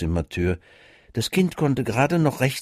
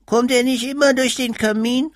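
put-on high, small child asking a question; a little kid wondering about the chimney; child's voice; 14 semitones higher, 4 dB louder than usual. A narrator's reading. Child asks mother and father about the chimney, high and curious. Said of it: "fragte das Kind".

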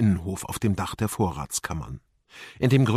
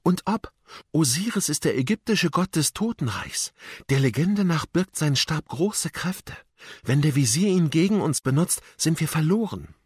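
lightly voiced Amira, higher and urgent; alarmed young guide; 6 semitones higher than usual. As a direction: warn companions of grave danger. Amira warnt vor dem Stab. (besorgt warnende, erhöhte Mädchenstimme)

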